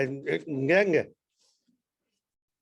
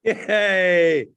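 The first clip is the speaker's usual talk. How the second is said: loud joyful yay; a loud, happy whoop; cheer; 7 dB louder than usual. Real, not acted. Cheering the read verse.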